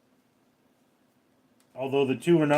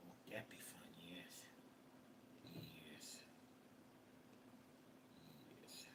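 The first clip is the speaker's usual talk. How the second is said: unvoiced whisper of "Won't that be fun?"; 9 semitones lower, 38 dB quieter, slower than usual. Streamer whispering to his cat Ghosty. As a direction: breathe it to the cat, voiceless.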